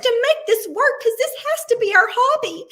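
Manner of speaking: high, loud, tearful wail; crying wail. Retold or imitated. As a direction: wail it out through tears, half laughing. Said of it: "And I go".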